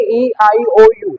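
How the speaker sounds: usual speaking voice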